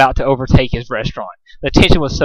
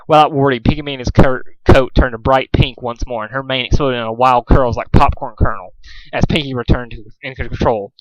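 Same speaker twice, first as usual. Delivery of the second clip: ordinary delivery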